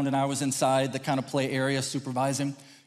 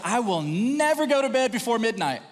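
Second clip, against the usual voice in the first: higher, grand mock declaration; emphatic vow; 7 semitones higher than usual. Preacher mimicking a night owl's vow, emphatic. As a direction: swear it off dramatically.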